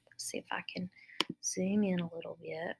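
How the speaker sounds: murmured while zooming; quiet technical aside; whispered aside. Real, not acted. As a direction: hush it as a side note. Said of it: "adjusting the screen".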